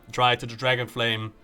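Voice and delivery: ordinary delivery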